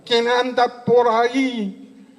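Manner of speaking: put-on high, slow quavering old woman; a tiny old nun calling into the dark; hammed-up frail old lady voice; frightened old nun.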